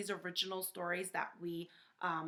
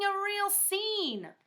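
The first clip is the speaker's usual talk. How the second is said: excited; 11 semitones higher, 4 dB louder, much slower than usual. Acted. Wolf tells of going crazy.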